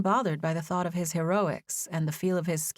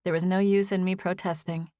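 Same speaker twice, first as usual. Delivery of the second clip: ordinary delivery, nothing marked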